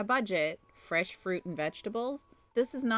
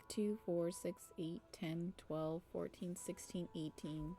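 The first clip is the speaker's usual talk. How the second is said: much softer, absent-minded tallying; counting under breath; 15 dB quieter than usual. Real, not acted. Parent tallying the oranges on the table, under breath. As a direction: count to yourself, barely voiced.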